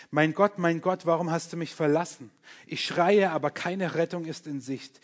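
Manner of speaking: loud, pleading lament read to God; impassioned psalm reading. Acted. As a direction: cry the psalm out like a desperate prayer. Reads psalm laments aloud.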